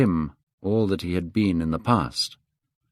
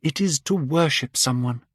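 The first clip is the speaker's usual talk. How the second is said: put-on young Dorian, ardent; 7 semitones higher than usual.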